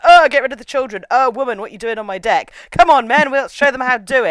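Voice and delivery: put-on high, loud, blustering old officer; sexist military captain. Captain ordering woman off his deck, sexist bluster. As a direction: bluster like a domineering older navy man.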